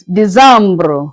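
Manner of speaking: "December" said "dee-zambruh", French style; "December" with a nasal middle and a rolled ending; French décembre. Shown as a demonstration. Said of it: "in French".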